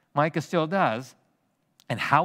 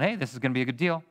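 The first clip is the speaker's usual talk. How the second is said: quick mock eager; much faster than usual. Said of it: "So he's going".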